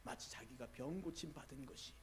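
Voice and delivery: usual speaking voice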